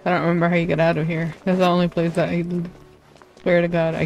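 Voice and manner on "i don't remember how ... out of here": ordinary talk, nothing marked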